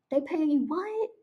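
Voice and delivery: high, incredulous squeak; stunned by the number, pitch up; shocked disbelief. Retold or imitated. Shocked at the salary.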